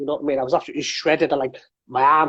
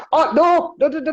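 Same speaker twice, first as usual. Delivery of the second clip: much higher, louder, panicked no's; flustered denial; 11 semitones higher, 3 dB louder than usual. Retold or imitated. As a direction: backpedal fast, embarrassed. Realising he embarrassed himself.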